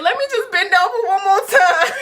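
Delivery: high, loud words cracking with laughter; laugh-cry the line; laughing through panic. Retold or imitated. Retold, laughing while nearly crying.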